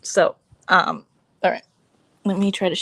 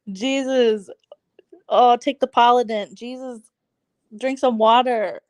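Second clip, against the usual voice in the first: normal talking voice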